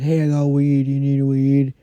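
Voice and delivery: mocking the coworker who offers weed and a warm seat; imitating coworker. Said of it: "He always is like".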